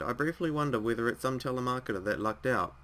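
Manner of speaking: usual speaking voice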